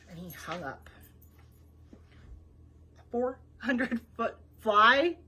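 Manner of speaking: ordinary delivery, nothing marked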